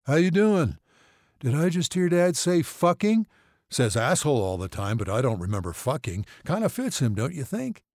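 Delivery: chatty and loopy on painkillers; drugged son. A narrator's reading. Drugged son asking mother about dad's swearing, loopy.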